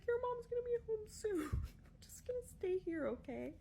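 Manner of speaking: squeaky, panicky reassurance; high scared voice. Retold or imitated. Babysitter on the phone, scared, squeaking high. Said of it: "And I was like".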